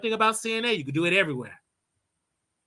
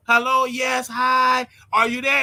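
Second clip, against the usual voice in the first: calling out; 7 semitones higher, 3 dB louder, slower than usual. Retold or imitated. Nurse calling into a silent room, raised and high. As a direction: call out to check someone's there.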